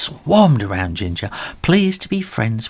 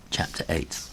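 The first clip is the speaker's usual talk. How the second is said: ordinary delivery, nothing marked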